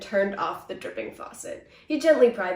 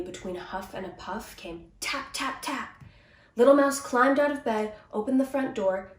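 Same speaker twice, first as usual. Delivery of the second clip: ordinary delivery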